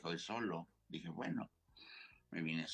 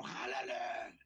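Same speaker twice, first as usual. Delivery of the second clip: shouted order; 4 semitones higher, much slower than usual. Retold or imitated. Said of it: "Y el otro".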